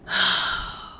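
long audible exhale; weary sigh. Real, not acted. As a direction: let out a heavy breath. Sigh after "My Lord.

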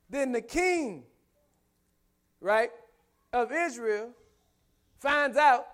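high, loud preacher's cry; full-force preaching. Acted. Preacher tells congregation the king is scared, full-force preaching. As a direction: punch each phrase out to the congregation.

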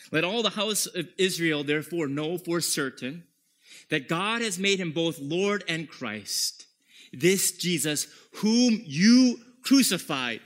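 rising to a loud, declaiming cry; Peter's bold accusation. Acted. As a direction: proclaim it to the crowd with force. Preacher voicing Peter's accusing speech.

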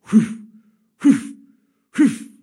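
loud sharp breathy whew sounds, like blows landing; loud mock spanking sounds; spanking whacks. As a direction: blow out each whew hard like a smack. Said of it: "then you finally hear it".

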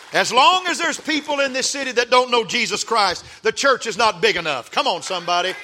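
high loud preacher climax; preaching at full force. Real, not acted. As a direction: preach it to the rafters. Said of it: "Help me preach right now".

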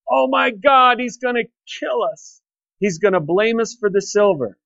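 a mock frightened gasp of dread; panicked. Brothers fearing Joseph will kill them, panicked and high.

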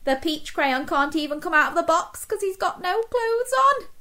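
plain reading voice

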